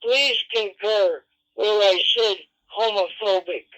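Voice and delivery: ordinary talk, nothing marked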